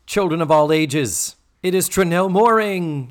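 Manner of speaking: booming circus ringmaster announcement. Pretend. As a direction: build up to a big announce.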